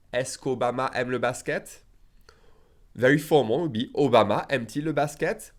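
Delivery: ordinary talk, nothing marked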